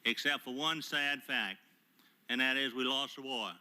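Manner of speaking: ordinary talk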